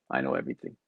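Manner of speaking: low, halting broken English of a Sicilian; imitated broken English. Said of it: "Broken English".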